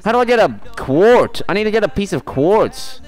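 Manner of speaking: high, loud, stammering exasperation; frustrated outburst. Real, not acted. Streamer needing quartz for a recipe, high frustrated outburst. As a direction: burst out high, restarting the sentence.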